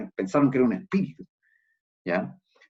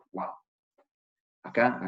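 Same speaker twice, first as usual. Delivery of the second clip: ordinary delivery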